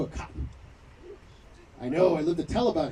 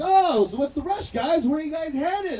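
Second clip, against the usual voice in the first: higher, louder questioning cop; traffic cop; 10 semitones higher, 7 dB louder, slower than usual. Retold or imitated. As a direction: imitate a cop stopping a speeding car. Acts out the cop pulling him over.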